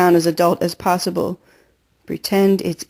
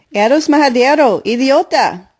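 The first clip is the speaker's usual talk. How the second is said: angry yell; 6 semitones higher, 4 dB louder than usual. Acted. Soaked girl yells at Keisha.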